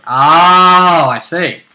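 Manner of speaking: long, loud drawn-out "oh"; mock understanding. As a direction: feign being impressed by a feeble boast. Mocking reaction to dragon boast.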